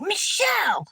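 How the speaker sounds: a mother calling her daughter to order; stern mother. Retold or imitated.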